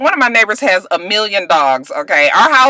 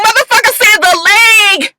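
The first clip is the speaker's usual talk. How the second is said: exasperated outburst; 12 semitones higher, 4 dB louder than usual. Real, not acted. Shocked at the stomach shot.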